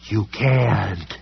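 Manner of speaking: creature scream. A narrator's reading. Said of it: "it screamed".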